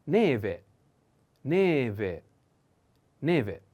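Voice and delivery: neve said clearly, stress on the first e, each take falling; slow teaching repetition. Shown as a demonstration. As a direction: model neve for students, accent on the first e. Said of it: "Put attention on the accent".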